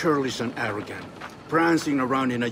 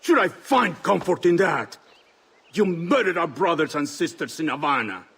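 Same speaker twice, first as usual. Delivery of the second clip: raised, higher rebuke, demanding an answer; stern accusation; 5 semitones higher, 4 dB louder than usual. Acted. Mentor accuses Kenway of murdering their brothers, raised and angry. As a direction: confront the traitor with rising anger.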